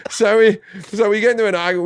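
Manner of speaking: ordinary delivery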